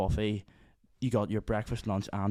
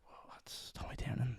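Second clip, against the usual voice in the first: soft, breathless whisper to himself; starstruck whisper; 2 semitones higher, 12 dB quieter than usual. Retold or imitated. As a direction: whisper it so he won't hear. Whispering as the star passes.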